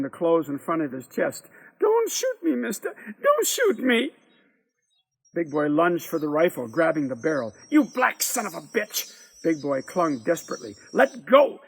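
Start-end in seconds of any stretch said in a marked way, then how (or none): 1.8-4.1 very high, frightened begging; terrified plea. A narrator's reading. Bobo facing the pointed rifle, high terrified plea. 7.7-9.1 higher, forceful insult; angry curse. A narrator's reading. Man fights boy for rifle. 10.9-11.6 loud, high command; angry order. A narrator's reading. Man struggles over rifle.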